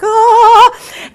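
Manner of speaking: high, loud gasp of a shriek; startled shriek of delight. Retold or imitated. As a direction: squeal like you've just seen your crush. Seeing her crush in lunchroom.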